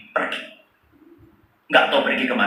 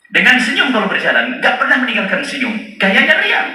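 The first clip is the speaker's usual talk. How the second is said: eager searcher; 9 semitones higher, 3 dB louder than usual. Acted. [name] describes smiling boy.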